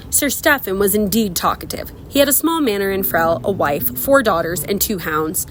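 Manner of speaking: plain reading voice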